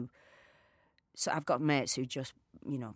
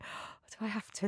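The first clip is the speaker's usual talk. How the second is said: reluctant; 7 semitones higher, 6 dB quieter than usual. Retold or imitated. Partner reluctantly asked to read in.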